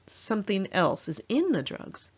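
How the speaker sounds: plain reading voice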